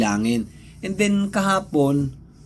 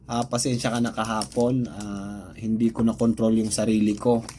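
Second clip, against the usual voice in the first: softer, lower, sorry tone; quiet apology; 5 semitones lower, 3 dB quieter than usual. Retold or imitated. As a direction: apologise gently and humbly. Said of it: "sorry siya sa akin". (mahinang paghingi ng tawad)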